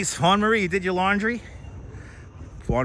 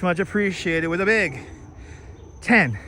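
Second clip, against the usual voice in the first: breathless on the climb; panting through the words; out of breath. Real, not acted. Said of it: "I'm out of breath".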